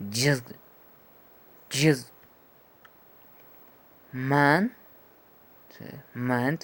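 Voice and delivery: each word punched loud for the class; teacher drilling English words; model words. Shown as a demonstration. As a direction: model each word clearly, one at a time. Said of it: "ejemplos claros".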